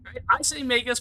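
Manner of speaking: normal talking voice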